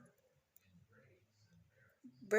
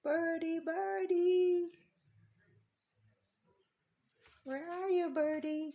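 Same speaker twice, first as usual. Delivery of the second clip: high sing-song bird call; coaxing a wild bird; 5 semitones higher than usual. Real, not acted. Calling a bird to the feeder.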